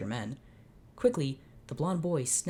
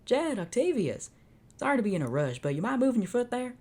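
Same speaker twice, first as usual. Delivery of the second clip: Jed cowboy voice; 8 semitones higher, 3 dB louder than usual. Acted. Reader voicing the toy cowboy Jed asking a favour, high cowboy voice. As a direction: play the little cowboy in a hurry.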